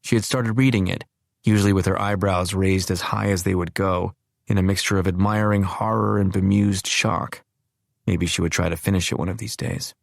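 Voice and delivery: usual speaking voice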